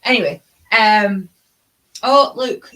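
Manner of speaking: ordinary talk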